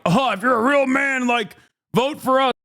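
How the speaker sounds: high-pitched mock macho pitch; mocking imitation. Host mimicking Republicans courting male voters, mocking. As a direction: parody their appeal to men, pitched well up.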